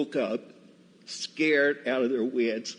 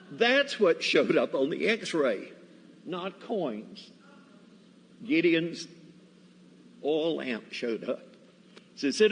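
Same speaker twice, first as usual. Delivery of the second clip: ordinary talk, nothing marked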